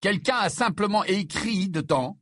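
high, indignant outburst; exclaiming. A narrator's reading. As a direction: exclaim in exasperated defence. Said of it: "s'exclama Harry".

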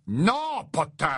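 high, drawn-out forbidding cry; cried-out refusal. A narrator's reading. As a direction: cry out to stop him. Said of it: "s'écria Rogue".

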